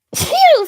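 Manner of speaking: sudden loud high laugh. Real, not acted. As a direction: burst out laughing. Laughing while telling sneeze story.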